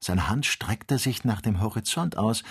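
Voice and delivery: usual speaking voice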